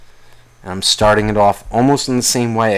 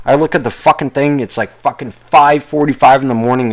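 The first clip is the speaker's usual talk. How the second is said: ordinary talk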